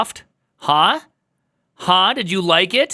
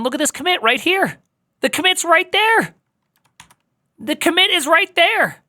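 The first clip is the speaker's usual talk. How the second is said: excited; 13 semitones higher than usual. Real, not acted. Finding the lost commit.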